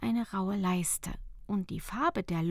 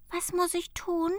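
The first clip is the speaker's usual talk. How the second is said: put-on higher, hushed girl's question; Fenja asks; 9 semitones higher than usual. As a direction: ask it eagerly as the girl. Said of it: "fragte Fenja". (Fenja fragt gespannt)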